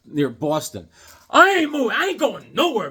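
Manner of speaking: ordinary talk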